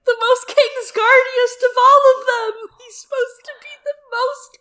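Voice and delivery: very high, squeaky laugh-crying; words squealed through real laughter; laughing to tears. Real, not acted. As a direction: barely get it out through helpless laughter. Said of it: "gonna cry".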